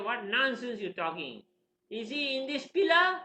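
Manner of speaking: high, mounting mock outrage; angry challenge. Angry father challenging son about the pillar, indignant. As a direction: build to a loud, high question.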